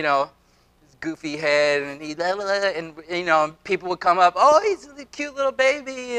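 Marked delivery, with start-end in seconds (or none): 4.4-6.2 put-on high, sing-song lady cooing at a baby; cooing stranger. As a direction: gush like a stranger over a stroller.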